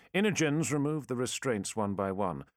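plain reading voice

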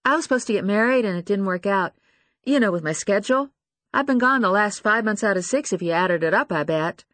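put-on insecure young woman. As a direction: rush it out insecurely. Lucy voiced, acting insecure.

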